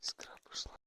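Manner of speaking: whispered echo. Real, not acted. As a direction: whisper the words back. Echoing quietly.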